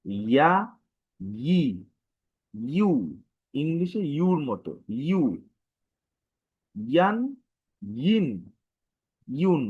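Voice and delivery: ইয়া ই ইউ ইয়ান ইন ইউন pushed out with pressure; forceful recitation of ইয়া ই ইউ; pressed vowel drill. Shown as a demonstration. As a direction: press each sound out for the class. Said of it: "pressure দিয়ে বের হবে".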